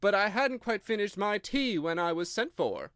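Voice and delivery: a hint of an anxious hatter pleading; nervous Hatter. A narrator's reading. Hatter voiced in story.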